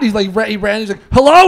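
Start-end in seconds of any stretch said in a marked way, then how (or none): 1.1-1.4 high, loud, eager pickup; hammed-up phone greeting. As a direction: answer the long-silent phone with mock excitement. Acting out answering the phone.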